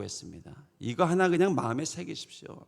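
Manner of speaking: ordinary talk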